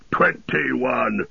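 lightly voiced dwarf calling a tally; a touch of triumph, drawn-out words; mild dwarf's cry. A narrator's reading. Gimli crying his tally, mild cry in dwarf voice. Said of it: "cried Gimli".

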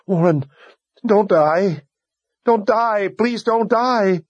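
high, pleading, tear-choked begging; a wife begging her dying husband, rising in despair; high, slow, tearful plea; through choking tears. A narrator's reading. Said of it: "past her choking tears".

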